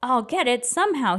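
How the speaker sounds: ordinary delivery, nothing marked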